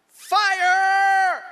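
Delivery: high, drawn-out shout from afar; yelled alarm. Retold or imitated. Someone on top yelling down to the searchers, imitated long high yell. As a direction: holler the alarm down the hill. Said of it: "somebody yells a word".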